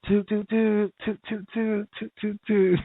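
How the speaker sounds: high mock car horn, toot toot toot; playful high imitation of a blaring horn. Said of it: "I start blaring my horn".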